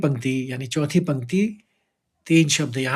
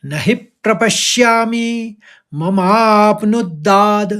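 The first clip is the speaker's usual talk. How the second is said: whole line chanted, stress on ममाप्नुद्दाद्; full line recitation; 4 semitones higher, 5 dB louder, slower than usual. Shown as a demonstration. Teacher reciting the first line in full for students. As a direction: recite the line in one breath. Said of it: "पूरे पंक्ति को इस तरह से पढ़ेंगे".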